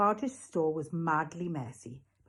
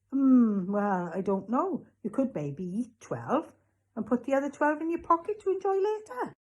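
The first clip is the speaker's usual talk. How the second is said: musing shopkeeper; 8 semitones higher, 6 dB louder than usual. Acted. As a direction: think aloud, then sell it. Raj musing as salesman.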